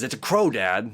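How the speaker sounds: retold irritated reply; mad son. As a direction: imitate a son losing patience with his dad. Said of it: "the son gets all mad and says".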